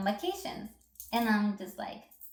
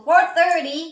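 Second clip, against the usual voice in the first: four thirty hit much louder and higher; stressed time; 6 semitones higher, 10 dB louder, slower than usual. Real, not acted. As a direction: punch four thirty in outrage. Complaining she learned it late.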